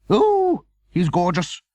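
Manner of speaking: high, loud gushing delight; delighted cry. A narrator's reading. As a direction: squeal with joy over the pet. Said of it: "she cried".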